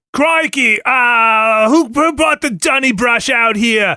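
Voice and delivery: hammed-up, high-pitched Australian caricature; Australian accent. Co-host performing on request for the email, bad Australian accent. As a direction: do a broad, exaggerated Aussie accent. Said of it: "Give me your best Australian".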